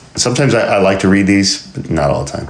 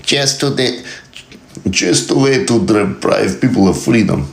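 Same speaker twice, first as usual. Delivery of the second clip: mock Russian accent, a touch higher; 5 semitones higher than usual. Shown as a demonstration. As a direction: read the quote again in a hammed-up Russian accent. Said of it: "Let's do it with an accent".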